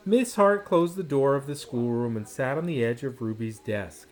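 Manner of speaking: ordinary delivery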